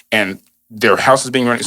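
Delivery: ordinary talk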